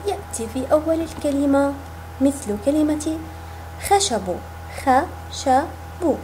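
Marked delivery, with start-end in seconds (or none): 4.8-6.2 خشب split slowly into خَ شَ بْ; teacher sounding out خشب for children; syllable by syllable. Shown as a demonstration. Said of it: "لنقطعها الآن معا".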